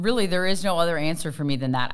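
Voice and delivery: ordinary delivery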